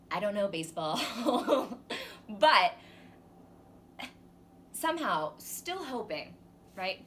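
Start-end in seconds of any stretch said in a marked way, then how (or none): 1.0-2.2 high, loud burst of laughter. Acted. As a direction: laugh at your own muddle, big and high. Laughs at own baseball muddle.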